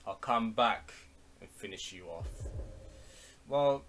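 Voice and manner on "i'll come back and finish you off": normal talking voice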